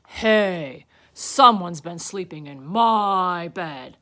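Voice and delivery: big, loud bear voice; Papa Bear. A narrator's reading. Papa Bear upstairs at his bed, loud indignant voice. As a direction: boom it slowly, indignant. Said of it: "Papa Bear went upstairs".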